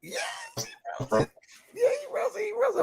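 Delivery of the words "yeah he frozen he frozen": high playful repeat, poking fun; high teasing sing-song. Real, not acted. Cohost teases partner whose video froze, high sing-song. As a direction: pitch it way up and tease him about the frozen screen.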